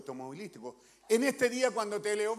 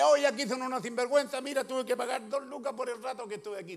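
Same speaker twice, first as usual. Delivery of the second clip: a mock outraged complaint, pitched up and louder; indignant complaint; 5 semitones higher, 6 dB louder than usual. Preacher voicing a churchgoer's mock complaint to police, indignant. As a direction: pretend to be fed up.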